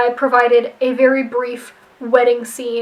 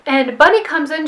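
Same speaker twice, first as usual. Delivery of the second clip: ordinary talk, nothing marked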